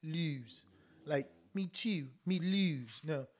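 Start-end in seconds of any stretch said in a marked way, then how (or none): 0.0-0.7 "lose" with a fronted, Baltimore-style oo; soft Baltimore-accented "lose"; Baltimore pronunciation. Acted. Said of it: "he spelled the L W O O S E". 1.5-3.0 soft attempt at a Baltimore accent; half-successful Baltimore accent. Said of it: "I can't even do it".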